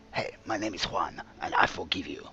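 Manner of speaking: low, lightly put-on Spanish accent; a gracious Spanish gent forgiving. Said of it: "a very forgiving Spanish gentleman".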